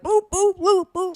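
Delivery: high-pitched rhythmic boops; playful cartoon sound effects for punches; imitated punch noises. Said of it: "Rolly said".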